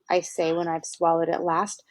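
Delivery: usual speaking voice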